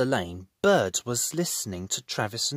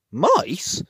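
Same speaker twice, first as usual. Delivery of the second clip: surprised question; 6 semitones higher, 6 dB louder than usual. Acted. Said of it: "said Bob".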